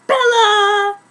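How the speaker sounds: ordinary delivery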